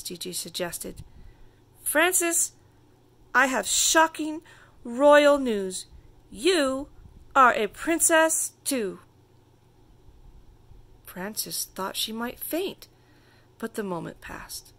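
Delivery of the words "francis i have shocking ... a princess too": high, loud, grand build-up; dramatic announcement. A narrator's reading. Princess calling her friend with big news, high and dramatic.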